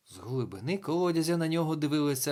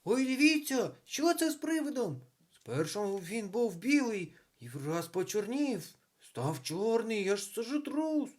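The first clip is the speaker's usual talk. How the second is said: put-on astonished children's cries, pitched way up; children calling out; 9 semitones higher than usual. A narrator's reading. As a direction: call out in a high-pitched kid's voice. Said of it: "діти загукали".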